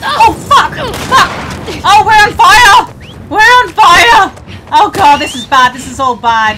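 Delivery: high, loud frantic yelling; panicked screaming. Real, not acted. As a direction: scream as the fire spreads. On fire in combat.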